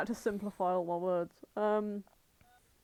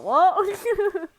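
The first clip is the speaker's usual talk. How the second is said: baffled what; 10 semitones higher, 8 dB louder than usual. Retold or imitated. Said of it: "I was like".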